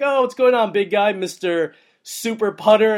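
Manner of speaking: high, loud, playful call, slowing on the nickname; booming mock-hero welcome to a buddy; excited teasing greeting. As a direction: hype him up like a hero.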